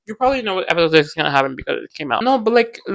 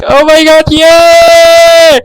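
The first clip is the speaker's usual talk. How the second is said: high, loud happy squeal; squealing joy; 11 semitones higher, 13 dB louder, much slower than usual. Retold or imitated. Fan squealing over the couple's big moment, high and loud. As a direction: squeal it like your favorite thing just happened. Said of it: "I'm like".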